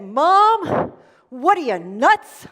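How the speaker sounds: put-on a bratty child shouting back at his mother; kid yelling. Son snapping back at mom, loud childish outburst.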